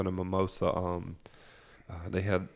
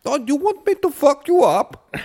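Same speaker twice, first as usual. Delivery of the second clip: high, loud, hammed-up Indian accent; slow, loud tough talk in a mock Indian accent; caricatured Indian accent, pitched way up; 14 dB louder than usual. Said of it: "how big and badass an Indian is".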